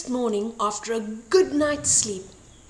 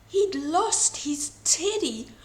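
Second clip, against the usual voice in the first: ordinary delivery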